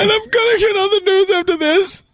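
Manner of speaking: high, wailing fake sob; hammed-up tearful whine; mock crying fan. Mocking the crying fan clip.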